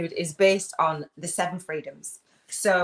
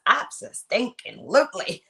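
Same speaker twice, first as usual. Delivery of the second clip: lightly imitated catchphrase, higher and louder; Robin; 6 semitones higher, 5 dB louder than usual. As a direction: quote it with a playful, emphatic lift. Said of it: "As Robin says".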